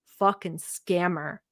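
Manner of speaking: quiet, slow, disgusted scammer; lots of disgust. Shown as a demonstration. Explaining kazıkçı, dripping disgust. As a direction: let the contempt drip off it. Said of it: "you have to save it with a lot of disgust".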